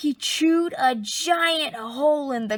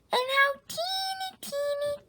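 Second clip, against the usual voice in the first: squeaky; 14 semitones higher, 5 dB quieter than usual. Acted. Sally mocks the tiny chicks.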